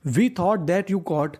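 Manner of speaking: very slow, words spaced apart; slow sentence reading. Shown as a demonstration. Teacher reading the finished sentence to students, very slow. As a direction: read it out slowly for the class.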